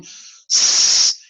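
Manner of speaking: voiceless s hiss; just air on the s; plain hissed s. Shown as a demonstration. Teacher contrasting unvoiced s with z. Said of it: "Say the letter S again".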